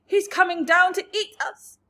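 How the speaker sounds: high, loud fearful wail; frightened wail. A narrator's reading. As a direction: wail in terror. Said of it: "wailed the old green grasshopper".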